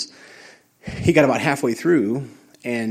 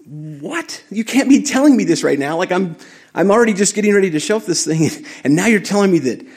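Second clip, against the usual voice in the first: incredulous disbelief; 8 semitones higher, 3 dB louder than usual. Retold or imitated. Retelling his shock at the claim.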